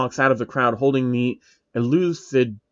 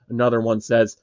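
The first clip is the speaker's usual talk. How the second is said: usual speaking voice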